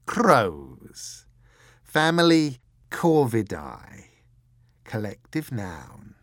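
loud, slow, grandiose pronouncement, easing on the last phrase; intoning. A narrator's reading. Croup lecturing his partner on crows, intoning grandly. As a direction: intone it like a Latin classification. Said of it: "intoned Mister Croup".

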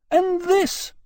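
very high, outraged cry; shouting. Acted. Jane shouting at the commissioners, outraged. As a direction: burst out in indignation. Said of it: "Jane was shouting".

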